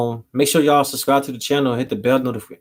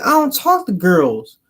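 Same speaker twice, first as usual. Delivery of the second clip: imitating her Southern accent, slightly higher and slower; higher-pitched Southern brush-off; 6 semitones higher, 4 dB louder, slower than usual. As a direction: draw it out in a mock Southern voice. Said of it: "she had that Southern accent".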